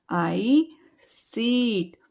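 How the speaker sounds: ordinary talk, nothing marked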